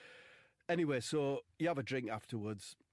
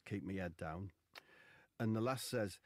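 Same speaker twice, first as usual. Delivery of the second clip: ordinary delivery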